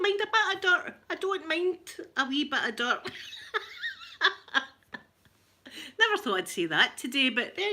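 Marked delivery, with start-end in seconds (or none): 3.2-4.7 squealing laugh, up high; high delighted laugh; high burst of laughter. Real, not acted. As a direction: let out a high shriek of a laugh. Laughing at own remark.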